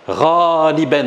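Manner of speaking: "raliban" stretched slowly and firmly; slow teaching delivery. Shown as a demonstration. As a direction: lay out "raliban" slowly for learners. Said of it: "je prononce avec vous".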